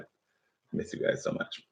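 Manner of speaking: soft unvoiced confession; whispered. Real, not acted. Teacher tells her online class she misses them, whispered. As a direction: breathe it softly.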